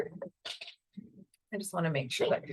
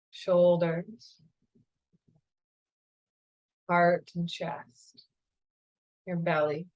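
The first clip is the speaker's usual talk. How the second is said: calm, unhurried meditation guide voice; guided meditation. Acted.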